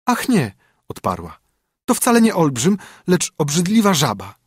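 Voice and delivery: put-on high, bright young princess. Princess answers king.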